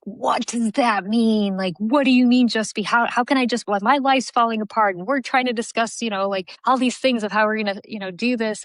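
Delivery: a hint of frustrated disbelief; aggravated. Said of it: "it was so aggravating".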